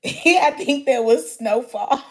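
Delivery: ordinary delivery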